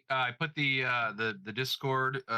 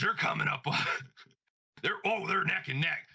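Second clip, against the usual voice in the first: Hulk Hogan voice; 5 semitones higher than usual. Retold or imitated. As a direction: shout it like a pumped-up wrestler. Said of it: "yeah, it was Hogan".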